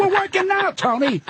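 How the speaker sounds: high-pitched, incredulous snap back at Tony; mock disbelief, voice jumping way up; exasperated retort.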